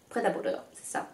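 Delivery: a quiet, unvoiced retry in French-accented English, checking it; whispered correction. Real, not acted. Vlogger muttering the brand name to herself in French-accented English, whispered. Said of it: "Vous voyez mon bel accent".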